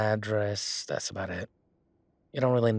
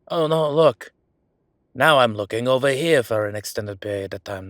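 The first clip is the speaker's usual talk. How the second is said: loud, hammed-up fake surprise; mock alarm, broadly announced; feigned distraction; 3 semitones higher, 8 dB louder than usual. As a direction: make an obvious show of being distracted. Feigns distraction to allow a photo.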